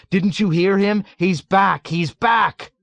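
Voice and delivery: high, loud insistent shouting; urgent shout. A narrator's reading. Someone warning the panicked patient, shouting insistently. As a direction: drive the alarm home loudly. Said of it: "someone shouted at him".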